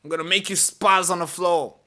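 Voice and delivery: high, loud mocking threat; mock officer warning. Officer threatening gunman with a taser, mocking high warning.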